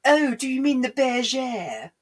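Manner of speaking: high, slow, affected neighbour voice; pretentious neighbour. Retold or imitated. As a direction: correct them with drawn-out snootiness. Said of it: "somewhat pretentious next door neighbours".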